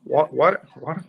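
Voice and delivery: usual speaking voice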